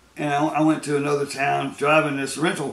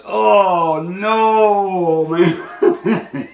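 loud, high dismayed laughing cry; 5 semitones higher, 6 dB louder, much slower than usual. Retold or imitated. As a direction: cry out in disbelief. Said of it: "this guy goes".

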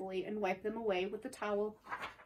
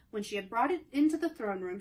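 ordinary delivery

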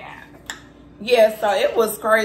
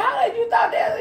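much higher, disbelieving mock shout; retelling asking the husband, a high incredulous mock yell; 12 semitones higher than usual. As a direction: fire the question back in disbelief. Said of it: "I said".

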